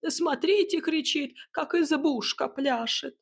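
high-pitched aggrieved lament; pained talking hut. A narrator's reading. Hut remembers her burning steps, high pained voice. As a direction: recall it with pain, in a high creaturely voice. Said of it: "с болью в голосе припомнила она".

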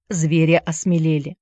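ordinary delivery

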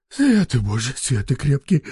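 breathless mutter. A narrator's reading. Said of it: "задыхаясь, бормочет".

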